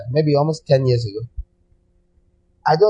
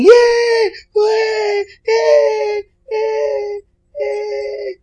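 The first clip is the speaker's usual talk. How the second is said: very high shrieking cheers, fading at the end; mock screaming yay; much slower than usual. Preacher mocking thrilled believers with shrieking cheers. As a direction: squeal like a thrilled crowd on floating seats. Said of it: "And you'll be screaming".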